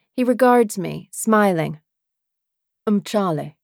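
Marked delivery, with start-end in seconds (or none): 2.9-3.6 put-on simple British-accented introduction; lightly voiced British man. A narrator's reading. Man introduces himself to the woman, British accent. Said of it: "that lovely British accent".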